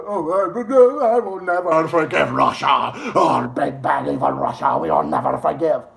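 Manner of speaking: mock Slavic accent, low and heavy; hammed-up Slavic accent impression; Zelensky. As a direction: put on a thick Eastern European accent. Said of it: "It's like Zelensky says".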